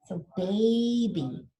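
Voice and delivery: put-on baby stretched slowly; slow sounding out. Shown as a demonstration. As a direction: model baby slowly for the class. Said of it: "try to sound it out".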